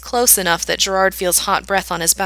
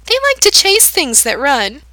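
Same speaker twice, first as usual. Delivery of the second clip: put-on high, louder, sickly sweet girl; menacing girl's sweet approval, high; sweetly; 8 semitones higher, 6 dB louder than usual. A narrator's reading. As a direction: praise him like a pet, too sweetly. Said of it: "the girl continues sweetly".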